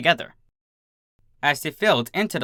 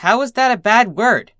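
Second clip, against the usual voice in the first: high, loud bewildered shout; 9 semitones higher, 6 dB louder than usual. A narrator's reading. Filly shouting at the slammed school door, bewildered. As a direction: yell it at the closed door. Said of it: "a blank wall to shout".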